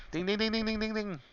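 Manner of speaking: singing the bell sound high; bell imitation. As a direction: chime ding ding like a bell. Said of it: "crap, there's the bell".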